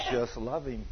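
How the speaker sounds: quiet low divine reply; voice of the Lord. Retold or imitated. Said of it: "And the Lord says".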